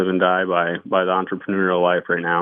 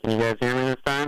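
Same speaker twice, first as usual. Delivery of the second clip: muffled bad phone line; garbled underwater connection; 4 semitones higher, 6 dB quieter, faster than usual. Real, not acted. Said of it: "it sounds like you're underwater".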